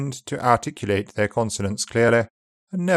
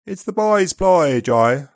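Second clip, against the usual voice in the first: upper RP accent; 5 semitones higher than usual. Shown as a demonstration. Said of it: "more open, even unrounded place in upper R P".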